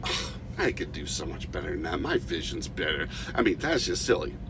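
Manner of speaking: low, soft, sneering scoff from the sidelines; sneering bystander. Retold or imitated. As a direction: grumble it like a know-it-all who isn't impressed. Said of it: "somebody from the sideline says".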